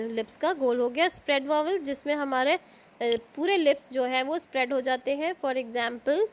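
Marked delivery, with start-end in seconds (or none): none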